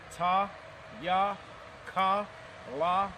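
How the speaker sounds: ordinary talk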